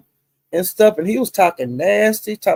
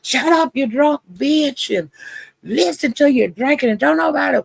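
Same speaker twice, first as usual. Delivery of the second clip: high-pitched mock yell, no real volume; mock screaming rant; retold screaming; 8 semitones higher than usual. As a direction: imitate someone screaming in an argument, pitched way up. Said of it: "He was just screaming at Royalty".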